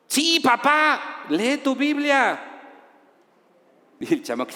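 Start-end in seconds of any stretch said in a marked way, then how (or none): 0.0-2.4 higher, slow, sing-song mockery; mocking son. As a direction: parrot the father's words back sarcastically.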